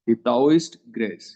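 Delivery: taoist grace stretched right out; slow drilled dictation; very slow naming. Shown as a demonstration. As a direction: spell the name out slowly for students. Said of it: "और दूसरे का नाम क्या है?".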